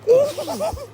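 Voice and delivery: very high squealing giggle; giggle burst. Real, not acted. Cracks up after naming Secretary.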